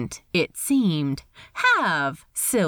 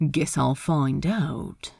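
Ed, offhand; 6 semitones lower than usual. Acted. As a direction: answer low and casual. Ed's offhand reply.

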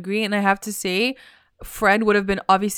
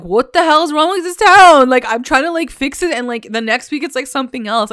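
higher, louder, fed-up rant; exasperated outburst; 5 semitones higher, 5 dB louder than usual. Retold or imitated. Archie fed up with the town, exasperated outburst. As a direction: vent like nothing ever gets fixed. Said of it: "you hear the exhaustion in his voice and, like, the desperation".

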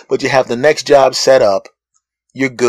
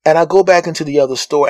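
ordinary talk, nothing marked

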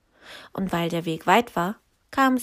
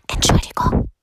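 hushed, voiceless apology; giant's whisper; 8 dB louder than usual. A narrator's reading. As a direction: whisper it shyly through the window. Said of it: "flüsterte der Riese".

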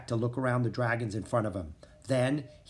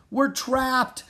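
alarmed; 12 semitones higher, 4 dB louder than usual. Acted. Boy realising they're trapped.

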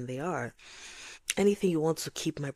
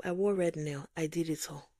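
ordinary talk

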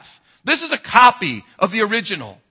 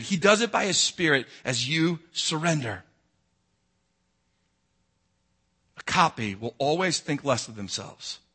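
low, soft, intimate sermon voice; soft preaching; 7 semitones lower, 6 dB quieter than usual. Acted. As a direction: draw them in quietly, almost confiding. Quiet reflective altar-call stretch.

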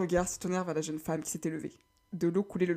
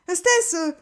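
effrayé; 9 semitones higher, 6 dB louder, slower than usual. Acted. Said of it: "s'écria Cali".

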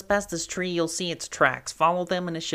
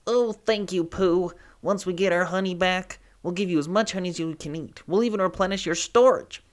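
bee voice, quick and joyful; buzzing with thanks, fast; grateful bee to Pooh, joyful; joyful exclaim; 4 dB louder, faster than usual. Acted. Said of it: "Han exclaimed as he joyfully buzzed".

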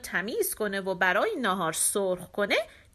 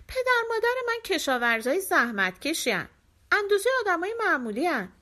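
Tom answering; 7 semitones higher than usual. A narrator's reading.

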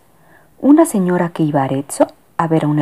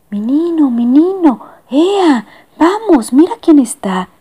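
high, sing-song coaxing; coaxing lady; 9 semitones higher, 3 dB louder, slower than usual. Acted. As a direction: coax the kitty awake sweetly. Lady coaxes cat awake.

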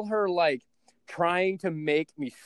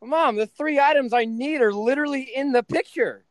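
higher, louder mock disbelief; exasperated; 10 semitones higher, 5 dB louder than usual. Son telling his panicking mom the items are right there, higher and louder. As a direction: throw it back at mom, incredulous. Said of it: "And I'm like".

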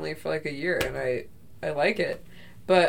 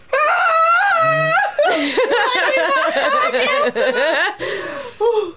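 long high shrieking laugh; screaming laugh; 3 dB louder than usual. Real, not acted. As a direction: howl with laughter. Laughing at fart.

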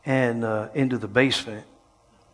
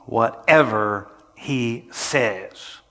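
mother's instruction; much slower than usual. Retold or imitated. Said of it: "and said".

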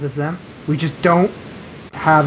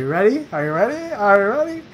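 rising, higher suspense tease; eager high-pitched teasing; teasing build-up; 6 semitones higher than usual. Real, not acted. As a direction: build the reveal, each question lifting upward. Building suspense before trivia answer reveal.

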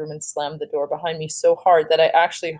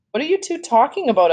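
higher, exasperated question without real volume; mild narrated shout; 7 semitones higher than usual. A narrator's reading. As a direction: read it as an understated shout. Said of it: "I shouted".

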